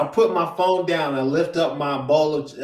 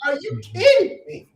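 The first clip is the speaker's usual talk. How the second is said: high, loud, disbelieving shout; can't believe what you're hearing; incredulous yell; 5 dB louder than usual. Retold or imitated. Venting to the co-host, an incredulous high shout. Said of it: "don't understand how upset I am".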